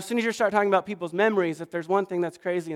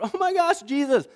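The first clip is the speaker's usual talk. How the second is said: much higher, joyful cry of recognition; thrilled Peter; 8 semitones higher than usual. Retold or imitated. Burst out with delight. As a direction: Peter spots Jesus from the boat, calling out thrilled. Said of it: "he jumps out of the boat".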